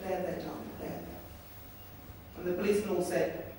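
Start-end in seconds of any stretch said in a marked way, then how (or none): 0.0-1.2 soft, hushed soothing; calm him like a baby; tender comfort. Retold or imitated. Comforting the boy in her arms.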